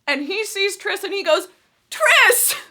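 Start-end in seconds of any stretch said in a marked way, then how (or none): 1.9-2.6 calling out. Retold or imitated. Drunk Four spots Tris from the railing: loud call. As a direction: call across the pit, tipsy and delighted.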